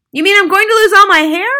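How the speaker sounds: high, very loud alarm; husband yelling. A narrator's reading. Husband fears losing his hair, yelling. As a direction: yell the question in horror. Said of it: "the husband yelled".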